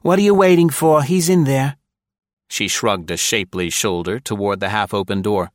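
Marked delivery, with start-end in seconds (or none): 0.0-1.8 loud, higher, fast impatient snap; impatient woman. A narrator's reading. Secretary waves the lieutenant into the office, impatient. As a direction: hurry him off with scorn.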